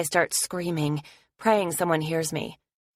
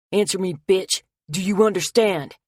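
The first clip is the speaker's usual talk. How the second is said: louder, snarling demand; angry attacker; 3 semitones higher, 6 dB louder than usual. Acted. Attacker pressing a knife to Anna, angry and loud. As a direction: force the answer out of her.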